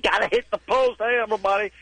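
high-pitched morning-show DJ parody; mock a zany DJ racing to the post; hyped radio DJ. Comic parodies a morning radio show host, hyped-up and high. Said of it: "It's not like".

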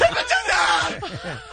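high, drawn-out comic yell. Shown as a demonstration. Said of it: "Say it louder".

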